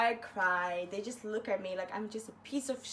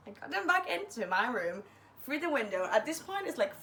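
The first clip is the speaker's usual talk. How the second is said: ordinary talk, nothing marked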